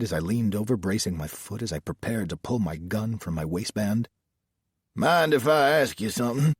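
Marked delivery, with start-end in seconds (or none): 5.0-6.5 higher-pitched Southern lawman; Southern sheriff. A narrator's reading. Sheriff searching the car questions the driver, Southern sheriff voice. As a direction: question him as a country sheriff.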